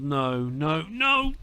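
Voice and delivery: building from groan to high yell; rising despairing shout. Real, not acted. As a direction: let the no climb into a shout. Opponent scores, despair builds.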